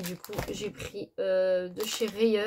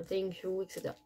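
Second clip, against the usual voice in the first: English with a French accent; 9 dB quieter than usual. Real, not acted. Reading card greetings to viewers, French-accented English. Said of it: "mon accent anglais n'est pas".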